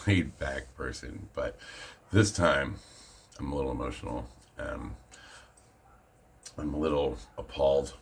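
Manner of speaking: normal talking voice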